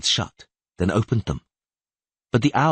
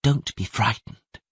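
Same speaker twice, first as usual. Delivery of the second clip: gentle voice; 3 semitones higher, slower than usual. Acted. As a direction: reassure him tenderly. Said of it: "said the gentle voice".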